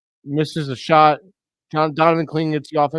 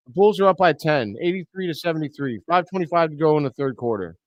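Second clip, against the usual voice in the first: usual speaking voice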